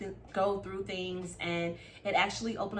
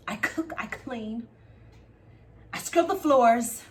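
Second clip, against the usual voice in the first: 1 building complaint; 4 dB louder than usual. Retold or imitated. Wife airing grievances about her husband at dinner, building from hurt to fed-up. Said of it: "Bo was like".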